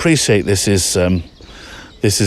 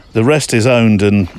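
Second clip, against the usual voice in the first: ordinary talk, nothing marked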